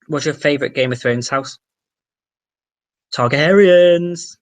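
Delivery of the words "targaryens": slow, loud, high proclamation; drawn-out proclamation. Acted. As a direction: stretch it out like a grand announcement. Answering favourite house, drawn out.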